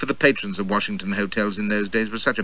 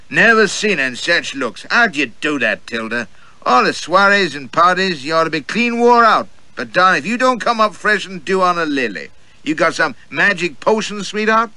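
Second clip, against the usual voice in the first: higher, louder gallant patter; gallant flatterer; 8 semitones higher, 5 dB louder than usual. Acted. Roue flattering Tilda at the table, high and gallant. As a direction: lay the compliments on thick.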